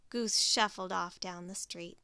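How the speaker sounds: ordinary delivery, nothing marked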